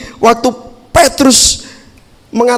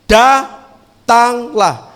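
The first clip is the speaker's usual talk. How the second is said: calm invitation; 3 semitones higher, much slower than usual. Retold or imitated. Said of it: "Yesus bilang apa?".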